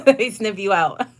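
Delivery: high loud laughing line; loud giggling delivery. Real, not acted. Laughing at own joke.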